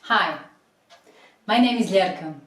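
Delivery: normal talking voice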